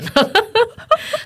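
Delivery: a short high laugh that rises. Real, not acted. Guest laughing at how she calmed down, short high laugh. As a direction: laugh at yourself.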